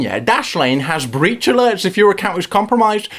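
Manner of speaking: ordinary delivery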